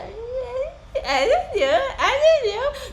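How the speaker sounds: put-on very high, slow, laughing whimper of a kid; laughing whine. Kid dithering, half laughing.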